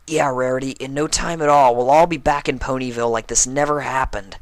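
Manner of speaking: pep her up brightly; upbeat encouragement. A narrator's reading. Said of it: "Dash told her".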